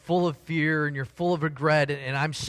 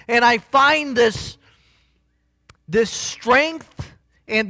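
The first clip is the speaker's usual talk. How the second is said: higher, louder, slowing into the build; full-force preaching; 8 semitones higher, 7 dB louder than usual. Acted. Pastor lifts his voice to the congregation, fervent preaching.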